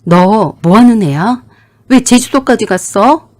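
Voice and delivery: loud, slow angry yell down the phone; shouting husband on the phone. Acted. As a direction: bark it out at her, furious. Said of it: "남편이 소리를 냅다 질렀어요".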